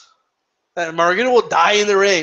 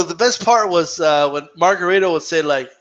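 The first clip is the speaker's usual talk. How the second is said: ordinary delivery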